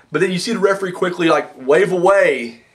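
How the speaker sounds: usual speaking voice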